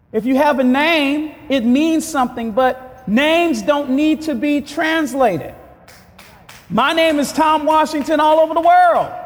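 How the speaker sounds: high, forceful preacher's voice; impassioned preaching. Real, not acted. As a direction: drive it home to the congregation.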